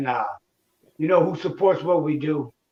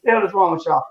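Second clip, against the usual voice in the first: ordinary talk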